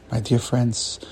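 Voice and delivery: ordinary delivery, nothing marked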